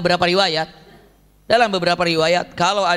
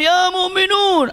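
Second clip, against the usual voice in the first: very slow, high drawn-out call; shouting call; 11 semitones higher, 3 dB louder, much slower than usual. Retold or imitated. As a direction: summon the whole crowd, stretched long and high. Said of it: "Maka berteriaklah". (seruan panjang bernada tinggi)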